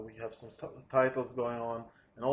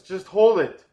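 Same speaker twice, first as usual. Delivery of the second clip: much louder and higher, commanding; urgent order; 8 semitones higher, 16 dB louder than usual. Acted. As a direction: shout the order to stop now. Mike insists the chopper stops.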